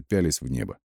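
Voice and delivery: usual speaking voice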